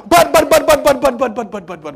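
stuttered buts sliding down and fading out; sputtering halt. Acted. Preacher acting out a party stopping, stammer winding down.

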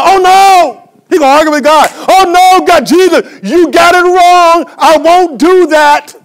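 high, very loud, drawn-out refusal; acting out Peter's defiant holler; shouted protest. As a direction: argue back at the top of your voice. Peter arguing with God.

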